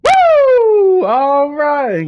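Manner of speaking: excited cheer. Acted. Hype character cheering the players in, loud whoop.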